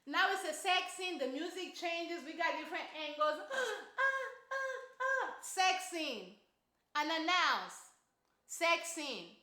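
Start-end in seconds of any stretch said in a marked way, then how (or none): 4.0-5.3 high breathy mock moans; mock moaning. Comic recapping a sex scene, mock high moans. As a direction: ham up the film's moaning, pitched high.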